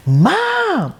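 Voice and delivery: high, loud shout of Mom; a boy yelling for his ignored mom; child yelling. Retold or imitated. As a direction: yell it like a kid calling his mother. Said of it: "he literally yelled".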